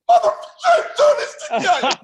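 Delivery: high, loud mock outburst; retold yelling. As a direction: yell it, re-enacting a joyful swearing match. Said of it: "We're just yelling at each other".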